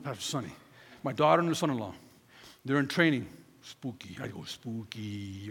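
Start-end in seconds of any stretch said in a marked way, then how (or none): 3.6-5.5 mock spooky. Mock spooky voice, dropped low.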